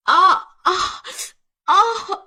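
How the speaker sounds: high, loud stabbed scream; short screams of agony; cry of pain. Acted. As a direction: cry out as the blade goes in. Wife stabbed.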